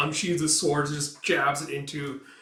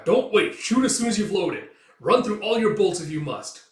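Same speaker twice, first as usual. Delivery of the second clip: loud, higher command to shoot; urgent order; 7 semitones higher, 7 dB louder than usual. Acted. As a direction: push the archers to fire.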